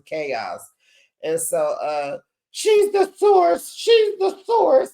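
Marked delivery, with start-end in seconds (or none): 2.5-4.9 slow, very high loud wail; mock hysterical crying. Imitating Lisa accusing Heather, crying hysterically. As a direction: drag it out in a hammed-up squeal. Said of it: "she's hyperventilating and crying".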